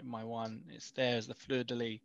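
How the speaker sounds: ordinary talk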